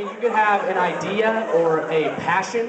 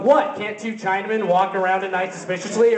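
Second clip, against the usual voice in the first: indignant; 2 semitones higher than usual. Retold or imitated. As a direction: snap back at the cop. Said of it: "And I'm like".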